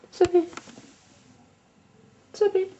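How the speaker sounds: high coaxing call; coaxing pet voice. Real, not acted. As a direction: coax the cat sweetly. Calling the cat.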